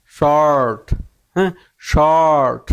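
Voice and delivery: শর্ট slow, with a long rounded "or". Shown as a demonstration. Teacher modelling an O-R word, slow round-mouthed শর্ট. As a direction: round and stretch the vowel in শর্ট. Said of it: "মুখটা গুল হবে তখন".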